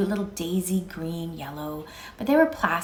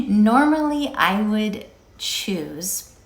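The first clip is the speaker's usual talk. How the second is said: laughing; 4 dB louder, much slower than usual. Real, not acted.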